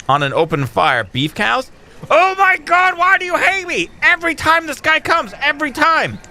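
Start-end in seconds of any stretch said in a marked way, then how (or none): 2.1-6.2 exasperated wail. Real, not acted. Gamer wailing at the supplier who never brings beef cows. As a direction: whine it out like you're betrayed.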